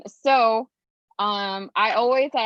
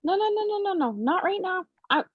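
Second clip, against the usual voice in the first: pleading; 8 semitones higher than usual. Real, not acted. Frozen screen, pleading with a child.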